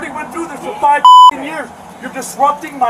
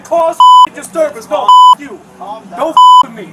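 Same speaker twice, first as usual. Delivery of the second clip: shouting at trespasser. Real, not acted. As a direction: scream it in their face. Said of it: "Why are you yelling?".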